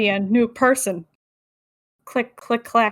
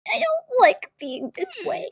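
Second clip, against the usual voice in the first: high character voice; 12 semitones higher, 5 dB quieter than usual. Retold or imitated. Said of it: "very poosh face".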